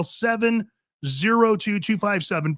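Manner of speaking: usual speaking voice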